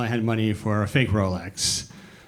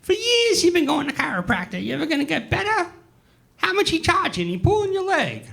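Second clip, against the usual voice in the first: put-on very high, scolding old-lady voice; mock the mother's doubts about the chiropractor; nagging old mother. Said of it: "And she goes".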